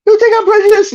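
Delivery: very high mock-indignant Archie Bunker. Archie asking Lionel, very high mock-offense. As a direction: squeak it out offended. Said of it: "It's like".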